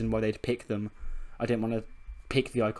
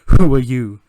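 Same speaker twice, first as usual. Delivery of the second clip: startled challenge; 16 dB louder than usual. Acted. Andre Chase to the hooded figure, loud startled challenge. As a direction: call it out at the stranger.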